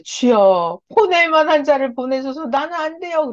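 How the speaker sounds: high, pleading protest to the Lord; Moses. Acted. Moses asking the Lord to send another, high pleading. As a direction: plead upward, as if begging to be excused. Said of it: "자, 십삼 절 모세가 이럴 때".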